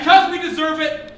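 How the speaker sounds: booming boss repeat. Acted. Boss repeats rally line louder.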